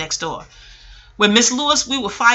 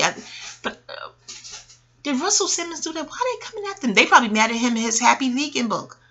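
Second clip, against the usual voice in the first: ordinary talk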